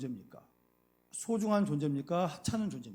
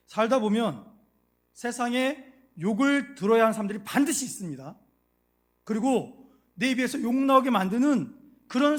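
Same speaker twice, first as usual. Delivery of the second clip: loud, emphatic sermon voice; forceful preaching; 4 semitones higher, 7 dB louder than usual. Acted. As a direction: preach it with conviction. (힘주어 외치는 설교)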